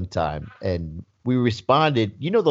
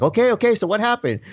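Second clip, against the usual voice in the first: higher, prodding retold dad voice; impatient dad; 9 semitones higher than usual. Dad prodding son for news on the phone, impatient. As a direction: imitate a father pushing his son for the news. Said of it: "And the dad's like".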